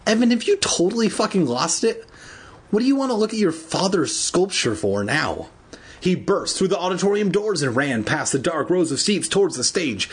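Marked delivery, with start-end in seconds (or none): 0.1-5.5 high-pitched incredulous questioning; acted exasperation, pitched up; exasperated disbelief. A narrator's reading. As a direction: demand an answer in baffled disbelief. Andy acted as baffled, exasperated.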